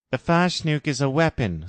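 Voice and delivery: warning Jim. Acted. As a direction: urge her to be ready. Jim explains.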